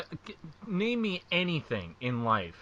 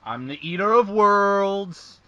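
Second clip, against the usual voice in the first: wrestling promo voice; 4 semitones higher, 7 dB louder than usual. Acted. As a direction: stretch it out like a grandiose promo. Mimics Bray Wyatt promo lines.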